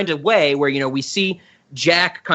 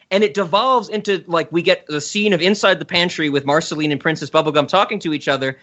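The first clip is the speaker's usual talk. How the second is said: ordinary talk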